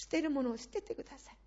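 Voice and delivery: ordinary talk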